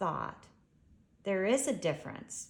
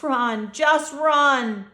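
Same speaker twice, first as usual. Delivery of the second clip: loud, slow, higher warning cry; yell; 7 semitones higher, 13 dB louder, slower than usual. A narrator's reading. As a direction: shout to her twins to flee. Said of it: "Mother Elin yells to her twins".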